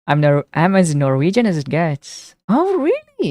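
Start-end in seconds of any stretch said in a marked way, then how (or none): 2.5-3.3 very high, amazed oh really; surprised. Real, not acted. Streamer amazed that a viewer is Norwegian, very high. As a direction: pitch leaps in delight.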